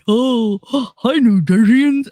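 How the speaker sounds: mocking the governor. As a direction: gasp then gush a phony hello. Mocking the governor's fake greeting.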